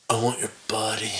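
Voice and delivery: low, soft, mock seduction; creepy come-on. Co-host imitates Mark's phone come-on, low and creepy. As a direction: drop low and quiet like a creep. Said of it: "that is a straight up creep".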